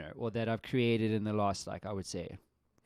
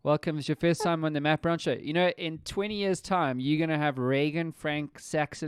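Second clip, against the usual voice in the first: higher and louder sing-song greeting; 5 semitones higher, 7 dB louder than usual. Real, not acted. Host welcoming young Sax onto the podcast, bright and playful. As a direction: welcome the little one onto the show.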